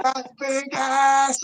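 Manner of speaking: high, long-drawn mock holler; Jerv yelling. Friend doubling down at the girls, mock yelling. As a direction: imitate a taunt called out slowly across a street. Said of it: "can't be yelling at us".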